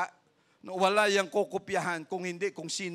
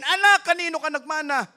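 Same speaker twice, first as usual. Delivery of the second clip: high, loud mock parent scolding; shrill-free but high, loud mocking parent voice; 11 semitones higher, 11 dB louder than usual. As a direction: ham up an exasperated parent asking their kid. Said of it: "At huwag mong sasabihin".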